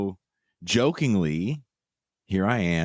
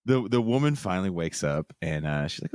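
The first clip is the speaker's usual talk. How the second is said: ordinary talk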